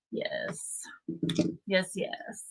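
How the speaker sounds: soft voiceless whispered cheer; whispered yes. Real, not acted. Streamer celebrating under her breath, whispered. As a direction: breathe the yeses out.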